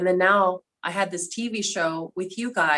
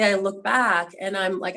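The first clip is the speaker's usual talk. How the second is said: ordinary delivery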